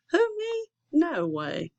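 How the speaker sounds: very high, feigned innocent squeak; mock innocence. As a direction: play dumb, all innocence.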